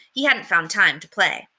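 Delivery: ordinary delivery, nothing marked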